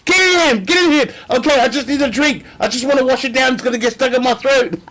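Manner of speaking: mock frantic shouting. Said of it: "They say".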